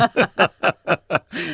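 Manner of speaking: high, delighted laugh at his own joke. Real, not acted. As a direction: burst out laughing at the punchline.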